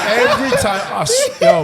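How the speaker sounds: high, breathless laughing oath; laughing hard. Real, not acted. Swearing the hat-trick story is true, laughing. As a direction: swear it through helpless laughter.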